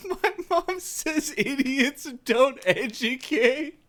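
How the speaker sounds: strained falsetto delivery with voice breaks; cracking high voice. Pretend. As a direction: push the voice up until it cracks. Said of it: "my voice is gonna, like, crack constantly while I talk like this".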